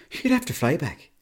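high punchline. Pretend. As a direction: toss it out high and quick in an Irish accent. Repeats joke punchline for emphasis.

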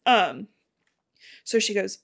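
usual speaking voice

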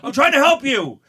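high, loud acted yell; retold desperate shout. Bartender pinning a bleeding man, retold desperate shout. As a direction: yell it full out at the attacker.

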